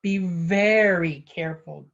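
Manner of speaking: slow warning with a loud, long VERY; emphatic caution. A narrator's reading. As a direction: lean hard on "very" and stretch it. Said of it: "added Poppet".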